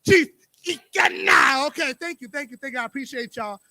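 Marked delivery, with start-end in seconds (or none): 0.1-1.7 African accent. Shown as a demonstration. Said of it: "with your African accent".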